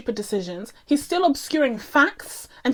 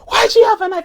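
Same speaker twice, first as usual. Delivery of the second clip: loud, much higher retold yell; dad shouting; 10 semitones higher, 8 dB louder, faster than usual. Dad shouting at his son about the knife, loud high yell. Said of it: "he's, like, shouting at him".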